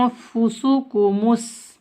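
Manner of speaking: आफुसकुमुस with the first syllable held as a nasal hum; ikhfa. Shown as a demonstration. Teacher showing hidden noon, nasal start to आफुसकुमुस. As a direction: chant आफुसकुमुस slowly, hiding the n. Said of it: "नून साकिन में इख्फा हो रहा है".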